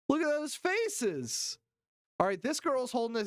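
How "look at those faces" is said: slow amazed. Real, not acted. Stunned at clip.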